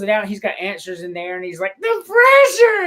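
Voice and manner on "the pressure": long, loud high-pitched cry; hammed-up agonized yell; mock anguished wail. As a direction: wail it out dramatically, drawn out. Acting Chevy Chase's mock outburst.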